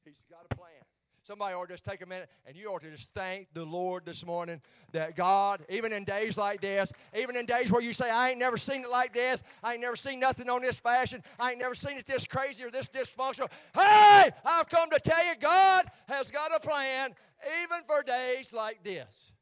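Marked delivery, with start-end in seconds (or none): 2.5-13.5 louder and louder, driving the congregation; building preaching. Acted. Preacher builds to full force. 13.7-19.3 full-force shout, higher, slow on the last lines; shouted preaching. Acted.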